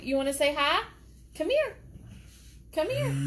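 high coaxing voice to the dog; pet talk. Real, not acted. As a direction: sweet-talk the dog over to the camera. Coaxing the dog onto her lap.

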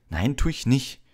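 heated schoolboy retort; angry. A narrator's reading. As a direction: deny it crossly. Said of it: "sagte Harry wütend".

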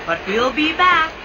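cheerful call. A narrator's reading. Dinos calling out.

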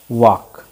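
वॉक said loud and clear; emphatic example word; example word stressed. Shown as a demonstration. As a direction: call out वॉक firmly to the class. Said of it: "आप word लिखते हैं".

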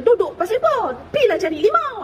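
a retold snap from a cranky healer; mock angry ustaz. Ustaz memarahi pesakit, dilakonkan semula. (ustaz yang garang diajuk dengan suara lebih tinggi)

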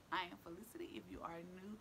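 breathy whisper, no voice at all. Acted. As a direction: lean in and whisper the intro like a secret. Whispered channel intro.

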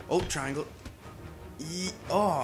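normal talking voice

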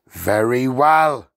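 slow, loud headmistress boom; big, drawn-out command. A narrator's reading. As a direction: boom it out slowly over the class. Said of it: "boomed the Trunchbull".

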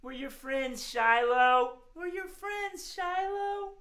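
high, sweet, a bit sung; sweet coaxing voice. Shown as a demonstration. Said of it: "Everybody say".

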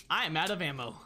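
ordinary talk

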